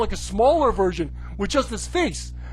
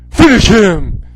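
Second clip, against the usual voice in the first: loud booming command; game announcer; 2 semitones lower, 10 dB louder, slower than usual. Pretend. Announcer calls the finisher, loud booming command. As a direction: bark it like a fighting game.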